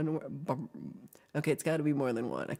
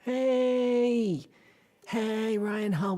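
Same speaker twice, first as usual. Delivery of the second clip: drawn-out, high, loud hey; calling out; 6 semitones higher, 5 dB louder, much slower than usual. Real, not acted. Streamer hails a viewer who just arrived, calling out. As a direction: call out a high, long greeting.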